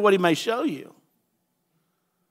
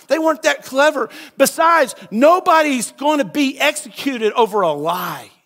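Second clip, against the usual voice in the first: higher, louder declaration; shouted point; 6 semitones higher, 4 dB louder than usual. Real, not acted. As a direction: shout it out to the church. Preacher shouting his point.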